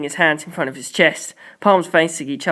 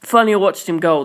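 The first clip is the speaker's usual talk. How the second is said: plain reading voice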